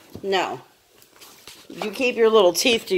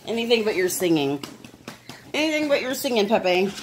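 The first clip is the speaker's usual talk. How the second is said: voicing the dog; 6 semitones lower, 4 dB quieter, faster than usual. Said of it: "She's like".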